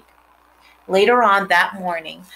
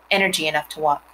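ordinary delivery

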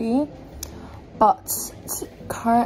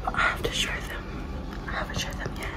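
secret hushed whisper; 6 dB quieter, faster than usual. Real, not acted. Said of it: "Guys, I'm in the other room".

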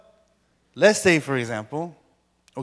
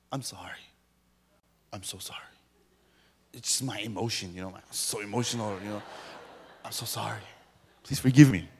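low, hushed pretend apology; mock remorse; 7 semitones lower, 7 dB quieter, faster than usual. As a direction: plead softly as if ashamed. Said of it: "then I feel bad about it".

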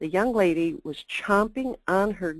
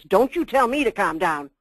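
louder, indignant comeback; mock indignant retort; 3 semitones higher, 6 dB louder than usual. Movie character bristling at being told to calm down, loud indignant. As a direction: snap back as the offended party.

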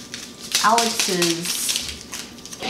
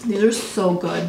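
talking between bites; chewing while speaking; mouth partly full; 3 dB quieter than usual. Real, not acted. Seen 0: Woman holds snack pieces, brings them to mouth while talking.

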